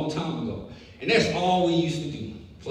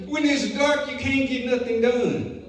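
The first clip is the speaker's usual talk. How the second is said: higher, quicker restatement; repeated point, higher; 8 semitones higher, 3 dB louder than usual. Shown as a demonstration. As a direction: lift it up for the repeat. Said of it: "The main point is".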